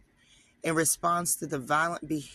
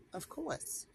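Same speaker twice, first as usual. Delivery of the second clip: much higher, softer, knowing of course; knowing sarcasm; 11 semitones higher, 9 dB quieter than usual. Real, not acted. Knowing aside to viewers, high and soft. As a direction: drop it as an I-told-you-so aside. Knowing remark about Jeroboam.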